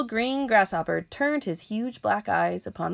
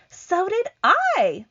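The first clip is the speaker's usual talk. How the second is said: very high, slow ladybug; 14 semitones higher, 6 dB louder, slower than usual. Acted. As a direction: chime in brightly.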